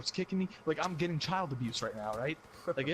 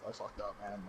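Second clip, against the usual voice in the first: quieter sheepish mumble; mock ashamed; 6 semitones lower, 6 dB quieter than usual. Son ashamed before the parents, quiet sheepish. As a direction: sound like a sad lost puppy.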